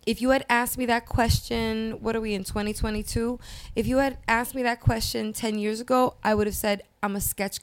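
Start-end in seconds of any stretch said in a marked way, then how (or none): none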